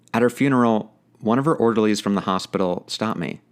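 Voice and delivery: ordinary delivery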